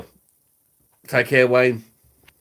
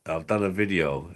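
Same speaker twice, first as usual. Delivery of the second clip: ordinary delivery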